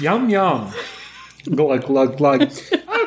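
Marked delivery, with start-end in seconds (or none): 1.5-2.5 spoken glug sounds. Acted. Mimics drinking noise.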